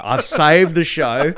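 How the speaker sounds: loud, drawn-out mock triumph; innocent triumph. Comic retelling his naive thoughts after his set, loud innocent triumph. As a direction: proclaim it proudly, slow and high. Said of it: "I was so pure and innocent".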